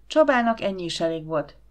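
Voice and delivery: ordinary delivery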